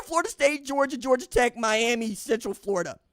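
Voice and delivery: usual speaking voice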